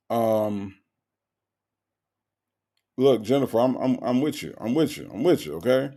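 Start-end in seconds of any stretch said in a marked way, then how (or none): none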